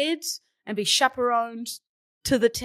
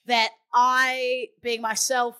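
ordinary talk

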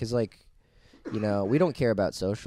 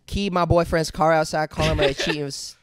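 mock complaining customer; 7 semitones higher, 6 dB louder than usual. Continuing the customer bit.

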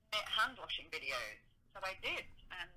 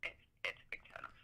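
whispered aside; 13 semitones lower, 10 dB quieter than usual. Real, not acted. Whispered aside about turn-offs.

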